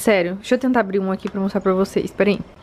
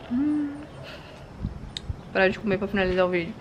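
eating while talking; mouth full; 8 dB quieter than usual. Real, not acted. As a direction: talk through a mouthful. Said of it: "falando de boca cheia".

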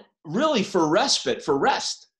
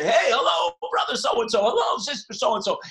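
calling out; 6 semitones higher than usual. Retold or imitated. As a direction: hail the neighbours through their windows. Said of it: "they would cry out to the different houses".

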